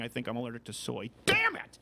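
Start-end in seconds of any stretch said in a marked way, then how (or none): 1.2-1.8 sudden outburst. Real, not acted.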